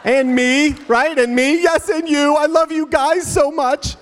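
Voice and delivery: very high, loud hammed-up wail; mock weeping. Retold tearful moment between dad and daughter, comic wailing. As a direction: blubber it like an overwrought child. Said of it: "And she was weeping".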